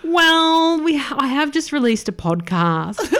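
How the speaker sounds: high-pitched retold admission; retold awkward confession. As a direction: own up to the psychiatrist, pitched high. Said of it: "And I'm like".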